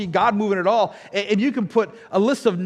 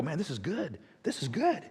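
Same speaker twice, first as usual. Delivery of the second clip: much softer, calm refrain; calm friend; 2 semitones lower, 10 dB quieter than usual. Retold or imitated. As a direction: say it softly, unbothered. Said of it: "sit there and go".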